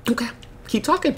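soft, high, pent-up menace; mock simmering kid; quietly plotting revenge. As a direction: hold back while secretly plotting payback. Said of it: "He's just like".

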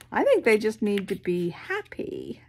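plain reading voice

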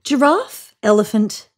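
usual speaking voice

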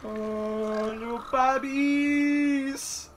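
put-on very high, very slow cooing baby voice; baby talk. Player cooing at the little creatures, high slow baby talk. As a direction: coo at them like tiny babies.

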